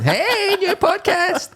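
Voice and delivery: high, loud wake-up yell; shouting. Pretend. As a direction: holler it like an over-excited human alarm. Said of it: "I'm shouting at you".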